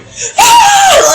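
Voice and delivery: sudden high, loud shriek; a startling shriek that jumps far up in pitch; imitated scream. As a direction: mimic a player's out-of-nowhere scream. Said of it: "he made all of us jump".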